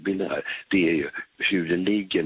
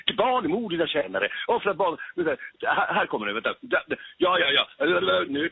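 worked-up stage voice; 6 semitones higher than usual. Shown as a demonstration. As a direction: voice lifted well above chat pitch. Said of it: "Nu arbetar jag upp rösten här". (uppjobbad, högre scenröst)

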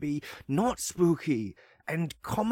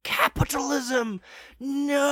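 anguished cry; 13 semitones higher, slower than usual. Retold or imitated. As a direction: shriek it in mock terror. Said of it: "and you're like".

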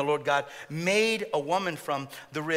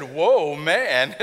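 mock amazed Adam; 2 semitones lower, 8 dB louder than usual. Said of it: "the pastoral joke is, he said".

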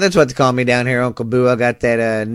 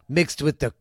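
ordinary talk, nothing marked

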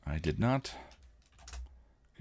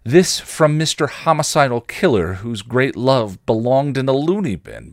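higher, louder sarcastic retort; raised voice, biting at him; stung snap; 6 semitones higher, 5 dB louder than usual. Acted. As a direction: snap back, hurt and indignant. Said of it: "Buffy snapped, stung".

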